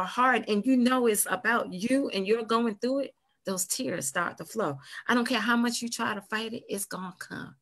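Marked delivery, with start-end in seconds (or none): none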